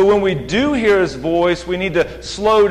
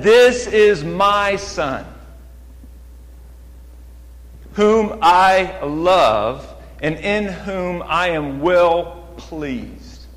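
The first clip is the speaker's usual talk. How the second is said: very slow, weighty proclamation; bold public declaration; much slower than usual. Acted. Preacher voices the Father declaring his Son publicly, slow and bold. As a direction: stretch each phrase, with long pauses between. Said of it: "with boldness. He declared it publicly".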